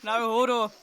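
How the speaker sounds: mock Scottish name; Scottish accent. Shown as a demonstration. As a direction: say Naruhodo with a thick Scottish burr. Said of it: "fun name to say in a shit Scottish accent".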